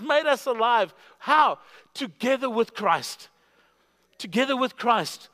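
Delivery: high, driving, emphatic proclamation; impassioned preaching. Real, not acted. Preacher declaring believers are together with Christ, impassioned preaching. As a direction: proclaim it to the room with conviction.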